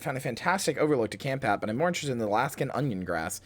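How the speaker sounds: journal reading. Acted. Reads log entries, growing uneasy.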